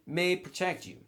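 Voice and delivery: normal talking voice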